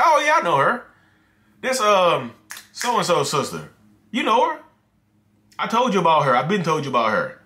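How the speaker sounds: high, loud, breezy fake innocence; acted gaslighting boyfriend. Boyfriend brushing off his girlfriend's photo with fake innocence. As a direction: play it cool and pretend you know her. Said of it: "okay, y'all, and scene".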